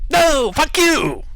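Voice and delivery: very high, slow, squeaky goblin yell; guttural squeaky creature. Acted. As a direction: screech it like a nasty little goblin. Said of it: "guttural, squeaky voice come through the door".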